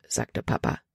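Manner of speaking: ordinary delivery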